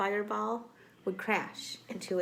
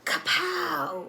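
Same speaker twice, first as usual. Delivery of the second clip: loud, high "Kapow!"; a big, high story sound effect shouted for the kids; 10 semitones higher, 6 dB louder than usual. Acted. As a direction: belt out the impact like a comic book. Robot hits the fireball.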